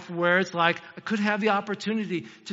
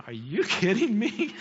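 laughing disbelief; 4 semitones higher, slower than usual. Real, not acted. Disbelief at grunting wrestler.

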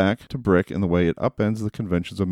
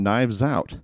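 ordinary talk, nothing marked

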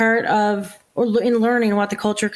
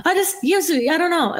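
laughing; 7 semitones higher than usual. Real, not acted. Laughing at being called dramatic.

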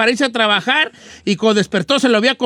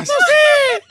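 mock crying wife. Wife crying, imitated by husband.

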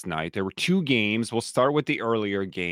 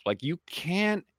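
exasperated rant; 7 semitones higher than usual. Real, not acted. Host rants about Browns' playcalling.

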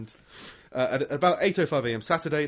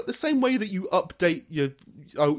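ordinary talk

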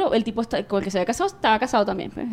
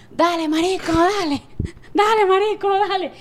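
imitated cheering; 8 semitones higher than usual. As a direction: egg on the exerciser like drunk friends, at contained volume. Mimics friends cheering from terrace.

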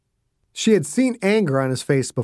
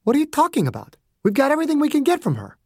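higher, fast indignant woman's voice; a touch of Margaret's disbelief; 11 semitones higher, much faster than usual. A narrator's reading. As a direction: blurt it out in quick disbelief.

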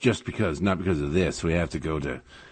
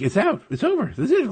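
put-on much higher, bright relieved child voice; imitating the daughter; faster than usual. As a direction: squeak it out like a relieved little girl. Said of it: "She's like".

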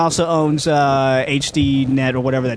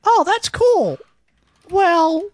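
mocking canned reply; 13 semitones higher, much slower than usual. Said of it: "It's all gonna sound the same".

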